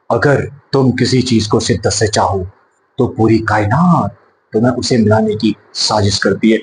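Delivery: low, soft filmy romantic delivery; Shah Rukh Khan dialogue. Retold or imitated. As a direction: deliver it like a Bollywood romantic hero. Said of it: "Shahrukh Khan साहब dialogue बोलते हैं". (शाहरुख खान के अंदाज़ में धीमा, गहरा dialogue)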